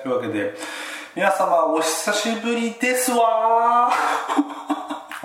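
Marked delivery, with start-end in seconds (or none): 1.1-5.2 put-on high, slow prim rich-girl lilt; ojousama voice. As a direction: drawl it like a haughty heiress. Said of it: "お嬢様言葉だと".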